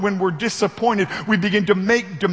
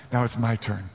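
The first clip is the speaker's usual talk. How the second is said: soft, low, calm sun; gently confident, like warm sunlight; warm beaming sun voice; 9 semitones lower, 5 dB quieter than usual. Acted. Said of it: "said the sun".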